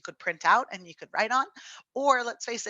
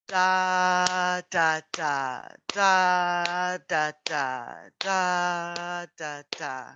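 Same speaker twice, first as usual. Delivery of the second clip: really slow; 4 dB louder, much slower than usual. Shown as a demonstration. Teacher slowing the dotted rhythm for students, slow chant. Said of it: "really slow".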